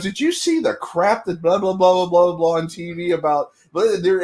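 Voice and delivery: breezy blah-blah impression of her complaining; a hint of the wife fired up about the news; imitating wife, lightly. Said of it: "she comes home and she goes".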